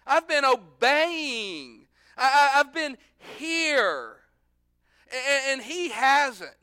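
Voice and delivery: high, loud, drawn-out protest; protesting older son. Acted. Older son pleading his case to father, loud protest. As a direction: stretch each claim slowly and forcefully. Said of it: "so he said".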